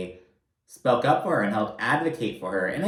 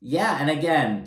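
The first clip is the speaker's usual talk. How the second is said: usual speaking voice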